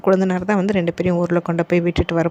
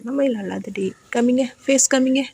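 gentle coaxing; 5 semitones higher than usual. Real, not acted. Mother asking her crying son to show his face, gentle coaxing.

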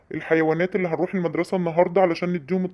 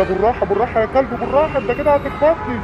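raised, higher-pitched scolding; annoyed driver; 7 semitones higher, 4 dB louder than usual. Acted. Doctor scolds driver who nearly hit him, annoyed. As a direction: tell off a careless driver.

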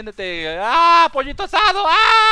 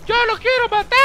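put-on much higher, excited kid voice; kids' eager voice; 14 semitones higher, 3 dB louder than usual. Retelling the kids' eager reply in a high child's voice. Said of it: "¿qué dicen ellos?".

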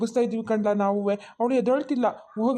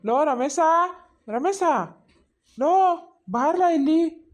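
loud, high call for the son; 5 semitones higher, 4 dB louder than usual. Acted. Father calling the sleeping son, loud and urgent. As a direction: shout him awake from afar.

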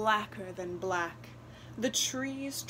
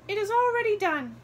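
wren voice; 12 semitones higher, 7 dB louder, faster than usual. A narrator's reading. Wren grants the wish.

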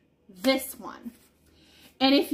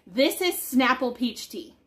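ordinary talk, nothing marked

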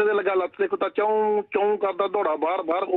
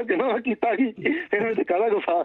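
higher, mock-aggrieved husband voice; henpecked husband; 5 semitones higher than usual. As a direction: whine it like a baffled husband. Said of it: "ਕਹਿੰਦਾ". (ਉੱਚੀ ਸੁਰ ਵਿੱਚ ਹੈਰਾਨ ਪਤੀ ਦੀ ਨਕਲ)

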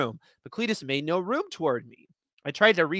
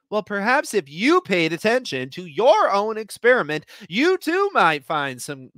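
haughty; 6 semitones higher, 5 dB louder than usual. Acted. Scolding rival, louder and slow.

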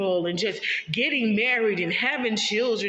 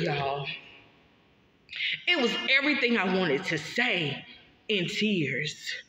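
ordinary talk

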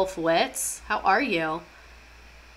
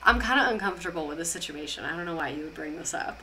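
softer and lower, uneasy backing away; mock awkward retreat; 4 semitones lower, 8 dB quieter than usual. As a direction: mumble like you want the subject dropped. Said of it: "And they're like".

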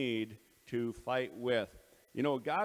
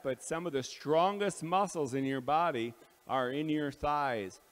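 ordinary delivery

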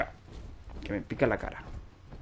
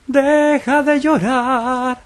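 much higher, drawn-out mock preacher voice; mock solemn sermon; 11 semitones higher, 5 dB louder, much slower than usual. Gamer mocking a crying enemy in hammed-up solemn tones. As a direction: stretch each word like a grand speech.

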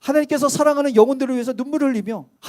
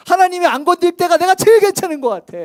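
usual speaking voice

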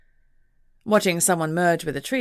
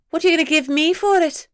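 high, louder pixie voice, bargaining; teasing pixie; 11 semitones higher, 5 dB louder than usual. A narrator's reading. Pixie to the detective, teasing her over the sack. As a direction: dangle the question as the pixie. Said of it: "she asked, holding it back".

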